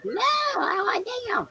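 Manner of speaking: very high, strained imitation shout; hammed-up high-pitched hollering; mock aggressive yell. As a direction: mimic a friend's screaming demand. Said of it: "that sounds so aggressive".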